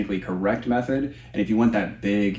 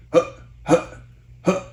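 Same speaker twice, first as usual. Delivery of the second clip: short huffs from the belly, higher; core grunts; 9 semitones higher, 4 dB louder than usual. Shown as a demonstration. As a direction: push out sharp belly huffs. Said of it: "we wanna kinda think".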